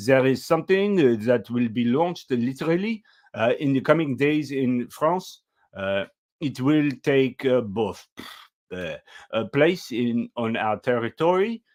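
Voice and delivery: mock French accent, lightly put on; bad French accent. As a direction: play Macron with a hammed-up French accent. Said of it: "it's about time I do a bad French accent".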